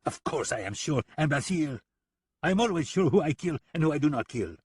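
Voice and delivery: high, quick and offended; touchy killer snapping back; incensed. Acted. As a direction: bristle at the insult. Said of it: "Lipov looked incensed".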